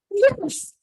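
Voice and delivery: high, thrilled yes; joyful yes. Retold or imitated. Answering the medium in the theatre, a thrilled yes. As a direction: burst out yes with delight.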